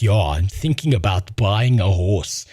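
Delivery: low dopey bro voice; mock the guy's clumsy flirting; mocking douchebag.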